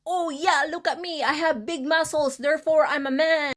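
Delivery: high, mocking voice of a boastful man; mocking macho man. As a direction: sneer it like a show-off flexing. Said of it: "they act like".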